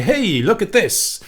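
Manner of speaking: high, loud "hey, look at this" shout; attention-grabbing call. Shown as a demonstration. Coach mocking a grabby presenter, loud call. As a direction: grab the viewer's attention loudly. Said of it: "It's not trying to say".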